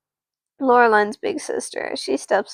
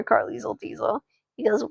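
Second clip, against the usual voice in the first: plain reading voice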